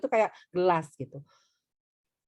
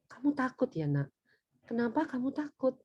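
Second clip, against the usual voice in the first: soft, caring questioning; gentle parent; 2 semitones higher, 6 dB quieter than usual. Acted. Parent asking a frightened child why, gentle. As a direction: ask softly, as to a small child.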